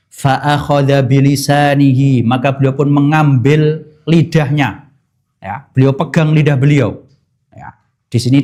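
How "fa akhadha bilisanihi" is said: measured, slow, reverent reading of the Arabic; slow Arabic hadith recitation. Acted. Preacher recites the hadith to the congregation, slow and reverent. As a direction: recite the hadith slowly and solemnly.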